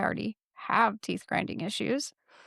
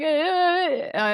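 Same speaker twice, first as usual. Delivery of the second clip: distressed stammer; 8 semitones higher, 4 dB louder, much slower than usual. Retold or imitated. Speechless distress, retold.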